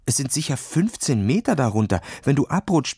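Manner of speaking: ordinary delivery, nothing marked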